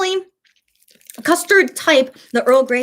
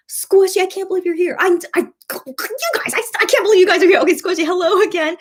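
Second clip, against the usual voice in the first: high, thrilled hello; excited greeting; 8 semitones higher than usual. Real, not acted. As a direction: gush over a returning friend. Greeting returning viewer.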